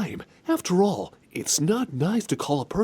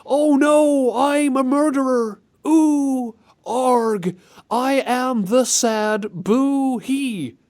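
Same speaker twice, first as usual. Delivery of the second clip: hammed-up sad boy; 7 semitones higher, 4 dB louder, much slower than usual. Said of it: "mocking the game line".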